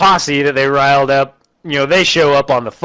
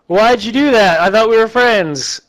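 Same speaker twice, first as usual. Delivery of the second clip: mock crying giant; 7 semitones higher than usual. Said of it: "And he's crying".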